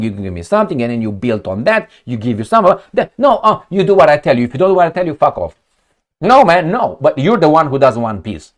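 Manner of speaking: ordinary talk, nothing marked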